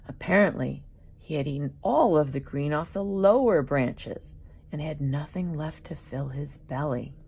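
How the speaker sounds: ordinary delivery, nothing marked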